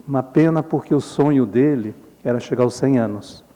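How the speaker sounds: ordinary talk, nothing marked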